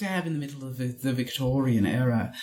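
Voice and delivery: prudish Victorian British character; British accent. Shown as a demonstration. Cohost describing Victorians in a prudish British accent. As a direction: explain primly in a British accent. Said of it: "if you talk like this, you're prudish".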